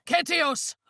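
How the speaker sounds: high, hard shout; battle shout. Acted. A warrior crying out mid-battle, shouting. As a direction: hurl the name across the battlefield.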